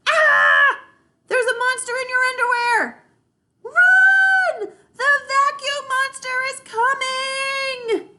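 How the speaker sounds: high, loud mock panic, sung out at the end; playful screaming. Little sister teasing her siblings with loud mock-panicked screams. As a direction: scream the warning like it's a game. Rascal pretending to warn of monsters.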